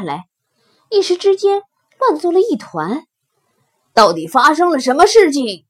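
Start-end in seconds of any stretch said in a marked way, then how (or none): 3.9-5.7 loud lion questioning the herd; demanding lion. Acted. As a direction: demand an answer loudly. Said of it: "狮子问". (狮子大声质问)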